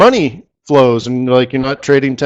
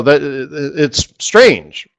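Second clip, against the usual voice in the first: ordinary delivery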